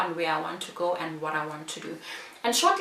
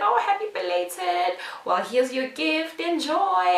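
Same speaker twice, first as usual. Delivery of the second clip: high, sing-song well-wishing; imitating cheerful recruiter; 9 semitones higher than usual. Recruiter handing over a belated birthday gift, cheerful. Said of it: "And she said".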